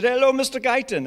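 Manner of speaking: high, loud mock Scottish greeting; hammed-up Scottish hello; bad Scottish accent. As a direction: imitate a Scot with a brutal accent. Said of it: "I have a brutal Scottish accent".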